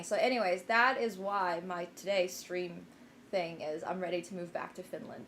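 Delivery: usual speaking voice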